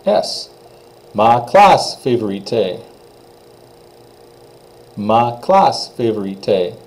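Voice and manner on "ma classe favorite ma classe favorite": Ma classe favorite slow with an open round a; slow teacher demo; French pronunciation. Shown as a demonstration. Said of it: "nice round sounds".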